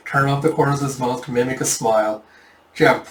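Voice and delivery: ordinary delivery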